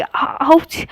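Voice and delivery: sharp high yelp of pain, ouch; sudden ouch. A narrator's reading. Pranali yelps as her finger gets bitten, sharp high ouch. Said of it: "प्रणाली एकदम से बोलती कि".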